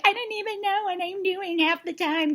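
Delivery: high, pleading singsong excuse; mock whining. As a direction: whine it as a flustered contestant. Imitates contestant's whiny defence.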